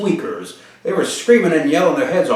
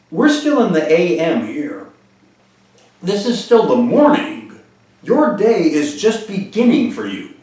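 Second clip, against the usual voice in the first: higher, emphatic encouragement with pauses between phrases; retold earnest pep talk, pitched up; encouraging pep talk; 7 semitones higher than usual. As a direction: lift someone's hopes for the day.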